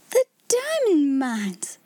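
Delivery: breathless, very slow whispered gasp. A narrator's reading. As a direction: gasp it out in horror, almost no voice. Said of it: "Miss Minchin gasped out".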